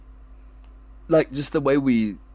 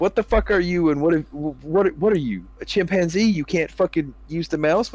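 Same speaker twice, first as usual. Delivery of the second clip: higher, mock-outraged flaming; mock trash talk; 7 semitones higher than usual. Gamer mock-flaming a teammate who misses hooks, higher and incredulous. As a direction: roast a whiffing teammate. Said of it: "I'm gonna look at you and be like".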